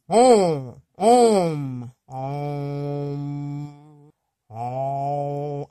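playful nasal om, trailing off; silly nasal om. Shown as a demonstration. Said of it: "Okay, I'm getting silly".

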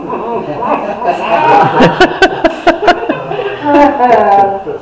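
big burst of loud laughing; loud laughter. Real, not acted. Reacting to friend's remark.

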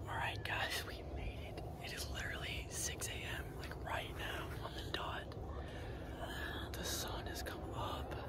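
hushed whisper at dawn; triumphant whisper. Real, not acted. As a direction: whisper it, quietly thrilled we made it. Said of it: "I don't even know why I'm whispering".

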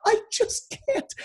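high, strained, tearful pleading; imitating crying torture victim. Retelling the tortured Winston, high tearful pleading. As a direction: mimic a broken man sobbing out his surrender. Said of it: "he's crying and spitting".

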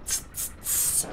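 a breathy mock goose hiss, drawn out; imitating a hissing goose. Goose called cobra chicken.

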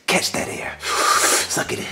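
soft unvoiced whisper, slow. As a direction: breathe it out in a whisper. Airing out nipples, whispering.

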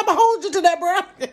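very high, loud laughing reply. Retold or imitated. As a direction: laugh it out delightedly.

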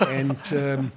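ordinary delivery

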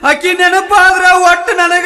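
high, loud comic wail; hammed-up lovelorn bawling; crying. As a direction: wail it slowly and break into long sobs. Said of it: "ನೀ ಅಳಬ್ಯಾಡ".

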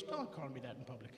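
low, soft dismissive dad voice; dad brushing off his son, low and soft; gruff dad. Retold or imitated. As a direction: drop low and brush the kid off. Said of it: "he looks down at me and goes".

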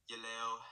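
"Yeleo" with a short e vowel, slurred and stretched; central pronunciation. Shown as a demonstration. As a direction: central Vietnamese take on "Yeleo". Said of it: "more stretched out".

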